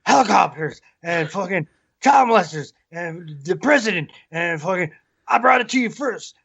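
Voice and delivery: Alex Jones rant. Retold or imitated. Mocking Alex Jones to the cohosts in a fast high rant. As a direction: ham up a frantic radio host. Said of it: "he's rich as shit off of going".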